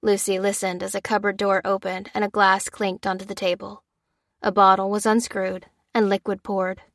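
ordinary delivery, nothing marked

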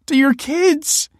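high, slow, tearful; wife reproaching him for the kids. A narrator's reading. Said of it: "wept Kelsey".

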